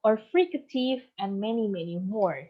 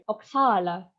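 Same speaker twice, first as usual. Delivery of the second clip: oxala with the middle syllable stressed, "o-XA-la"; stress on second syllable; 2 semitones lower than usual. Shown as a demonstration. Lecturer showing word stress to students, oxala stressed on XA. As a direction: lean on the XA in oxala. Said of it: "the stress in the second syllable".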